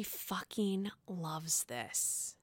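slow conspiratorial whisper. Real, not acted. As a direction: lean in and whisper the gossip. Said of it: "conspiratorial aside about Kurt".

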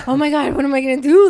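panicked breathing. Retold or imitated. Retelling the pregnancy test, fast panicked gasping. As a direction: relive the panic of the positive test. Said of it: "I started, like, hyperventilating".

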